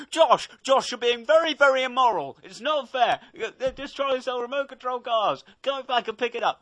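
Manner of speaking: high-pitched, whiny imitation of scolding; mock moralising friend. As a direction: nag him in an exaggerated high voice. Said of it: "just started going".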